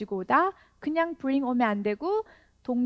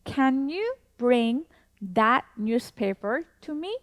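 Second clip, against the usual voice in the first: very slow, each word spaced out; slow teacher demo with a rising question end; slow model sentence; 2 semitones higher, much slower than usual. Shown as a demonstration. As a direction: model it slowly for learners to repeat. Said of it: "다시 한 번 더".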